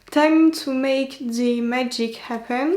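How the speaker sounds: slow English line with a French accent; French-accented English, said slowly. Real, not acted. Vlogger jokes to viewers about her English, French accent. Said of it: "On admirera mon accent".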